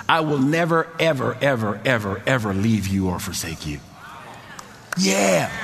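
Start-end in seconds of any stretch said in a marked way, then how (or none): 0.1-3.8 "never, ever, ever, ever, ever" each word hit and dropped; stress on NEVER and EVER. Shown as a demonstration. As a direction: lean hard on "never" and every "ever". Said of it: "with this emphasis".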